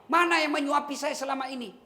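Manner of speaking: high, loud, demanding questions; suspicious blind man. Retold or imitated. Blind man rejecting a rough stranger, high and demanding. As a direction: confront the stranger sharply.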